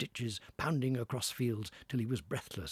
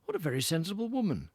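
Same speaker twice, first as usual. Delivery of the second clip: brisk gentleman; 8 semitones higher, 4 dB louder, faster than usual. Acted. Gentleman speaking.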